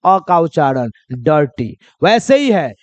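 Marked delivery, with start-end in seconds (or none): 1.2-1.8 "डर्टी" as DUR-tee, low and falling; short neutral vowel before r in "डर्टी"; correct reading. Shown as a demonstration. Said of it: "किसका उच्चारण?".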